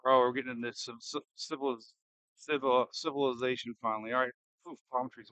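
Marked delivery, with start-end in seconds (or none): none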